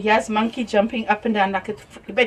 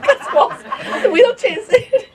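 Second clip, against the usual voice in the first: laughing; 13 semitones higher, 4 dB louder, slower than usual. Real, not acted. Laughing while telling story.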